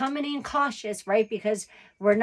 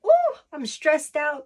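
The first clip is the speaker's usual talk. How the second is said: higher, louder, slowed mock panic; mock stressed; 6 semitones higher, 4 dB louder, slower than usual. Reader voicing a stressed querent, hammed-up high slow wail. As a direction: ham up a stressed little wail. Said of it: "it's like".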